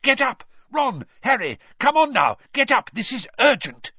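loud, high urgent shout. A narrator's reading. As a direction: yell them awake in alarm. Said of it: "Mister Weasley was shouting".

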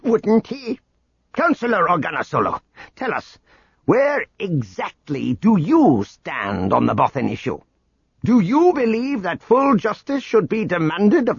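high, sharp accusing tirade. A narrator's reading. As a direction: jab each question like an accusation. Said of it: "a finger stabbing out accusingly".

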